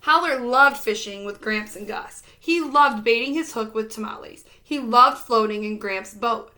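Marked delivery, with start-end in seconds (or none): none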